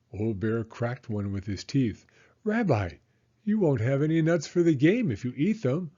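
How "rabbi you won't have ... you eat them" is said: put-on higher, bright old grandmother voice; Baba Brena. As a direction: play the kindly old hostess, pitched up.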